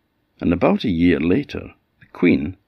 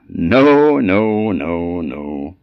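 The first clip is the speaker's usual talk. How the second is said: emphatic refusal; 4 dB louder, much slower than usual. A narrator's reading. Little man turns down the guess, dismissive. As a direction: knock it back in a descending cascade.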